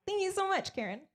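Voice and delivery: high, sugary thank-you; mock sweet reply. Comic mocking her own thank-you to Karen, pitched high. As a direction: gush it back up high.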